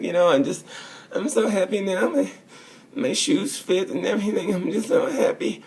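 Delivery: high, giddy, overjoyed voice with a laugh; gushing Zarco impression. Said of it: "and he's like".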